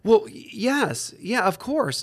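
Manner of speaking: high, halting, taken-aback agreement; stunned. Retold or imitated. Wife answers husband's unexpected question, imitated as stunned and hesitant. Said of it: "stunned by that".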